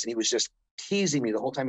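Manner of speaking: normal talking voice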